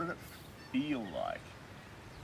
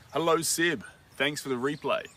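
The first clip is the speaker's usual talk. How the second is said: louder, higher hello to a viewer; bright greeting; 5 semitones higher, 7 dB louder than usual. Real, not acted. Greeting a viewer who watched the replay, cheerfully louder. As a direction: call out a cheery welcome.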